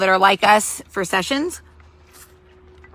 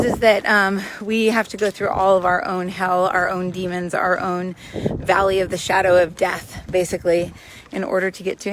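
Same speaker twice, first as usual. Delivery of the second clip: ordinary delivery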